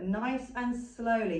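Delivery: drawn-out "Nice and slowly", each word stretched; slow demo. Shown as a demonstration. Instructor showing handlers how slowly to lift. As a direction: ease it out slowly, like lifting the dog. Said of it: "You wanna go as slow as this".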